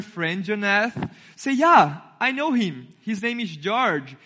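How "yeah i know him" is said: ordinary delivery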